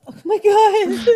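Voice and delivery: high, slow gasp of shock; retelling her jaw-drop moment; stunned disbelief. Retold or imitated. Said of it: "I was like going".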